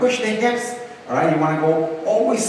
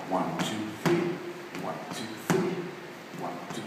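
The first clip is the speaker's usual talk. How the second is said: low, hushed dance counts; quiet step count; 11 semitones lower, 12 dB quieter than usual. Shown as a demonstration. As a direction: count the steps softly under your breath. Counting the other side while demonstrating.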